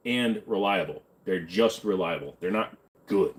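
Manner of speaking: usual speaking voice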